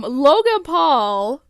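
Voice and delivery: slow, emphatic "Logan Paul" like a newscaster; news anchor voice. Pretend. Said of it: "I sound like a news anchor".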